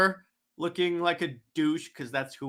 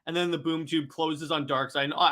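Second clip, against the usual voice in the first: ordinary delivery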